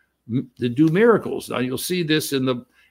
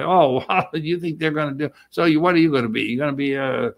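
normal talking voice

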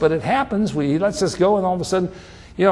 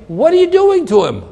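much higher, louder accusing cry; rebuking cry; 10 semitones higher, 4 dB louder than usual. Acted. Preacher rebuking a careless parent, high and loud. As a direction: call the parent out sharply.

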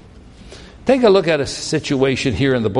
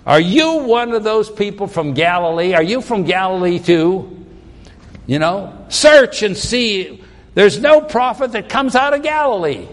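higher, louder mocking challenge; scoffing retort; 6 semitones higher, 3 dB louder than usual. Pharisees taunting Nicodemus, loud and scornful. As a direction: sneer him down as a Galilean.

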